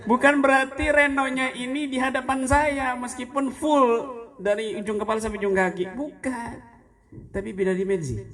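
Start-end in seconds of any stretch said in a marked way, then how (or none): 0.1-6.5 laughing emphasis. Real, not acted.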